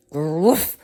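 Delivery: loud imitated woof; dog bark. Frightened dog barking at the alligator.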